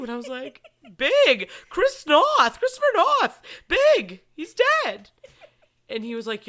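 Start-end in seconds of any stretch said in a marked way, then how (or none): 1.0-5.1 high, loud mock-sobbing wail; retold crying. Explaining to husband who died, hammed-up crying. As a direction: wail each name in hammed-up anguish, pitched way up. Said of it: "You're crying like it's somebody you".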